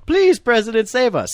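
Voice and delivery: high, loud, hammed-up begging; mock desperate plea. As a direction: whine it like a scared citizen begging to be saved. Mock public pleading to president.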